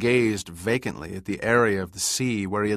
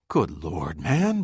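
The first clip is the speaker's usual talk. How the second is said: pleading; 2 semitones lower, slower than usual. A narrator's reading. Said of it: "pleaded Birch".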